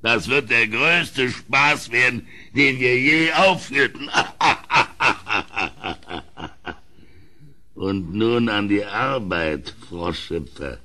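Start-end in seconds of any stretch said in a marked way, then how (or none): 4.1-6.7 breites, genüssliches Lachen; vergnügtes Lachen. Acted. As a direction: lach in Vorfreude auf den Spaß. König lacht vorfreudig. (der König lacht vorfreudig über den größten Spaß)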